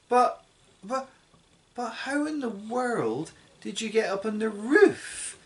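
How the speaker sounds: mother staring up at grandma on the roof; astonished cry. A narrator's reading. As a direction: cry it out in disbelief. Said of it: "cried the mother".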